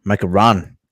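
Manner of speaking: ordinary delivery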